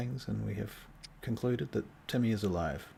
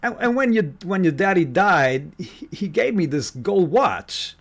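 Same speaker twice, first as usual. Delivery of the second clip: Al Capone voice; 7 semitones higher, 13 dB louder than usual. Acted. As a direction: growl it like a mob boss. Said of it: "This is the Al Capone voice".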